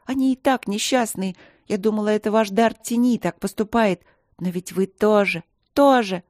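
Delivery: put-on a girl furiously scolding a grown man; angry tirade. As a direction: pour out the outrage, pitched high.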